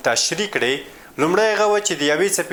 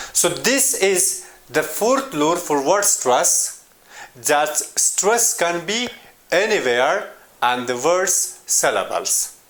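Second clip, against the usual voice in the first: usual speaking voice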